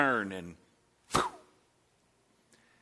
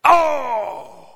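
high loud sympathetic oof; pained groan; 11 semitones higher, 7 dB louder than usual. Retold or imitated. Watching a football player get flattened, pained oof. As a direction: wince out loud at the hit. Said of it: "and you go".

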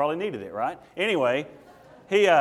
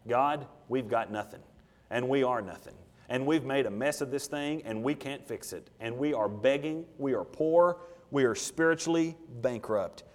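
slow, softer, lowered praying voice; humble prayer; 4 semitones lower, 5 dB quieter than usual. Acted. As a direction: plead quietly with God, owning up to failure.

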